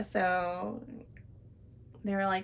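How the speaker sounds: usual speaking voice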